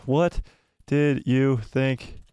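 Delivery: slow, spaced-out words as you type; slow typing dictation. Real, not acted. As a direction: dictate it word by word to yourself. Dictating a poll while typing it.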